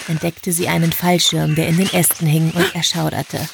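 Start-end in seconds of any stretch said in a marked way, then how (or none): none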